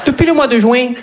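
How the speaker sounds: southern French twang in a Manhattan love scene; southern French accent. Retold or imitated. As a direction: play the romcom lovers with a Midi accent. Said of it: "une comédie romantique à New York avec l'accent du Midi".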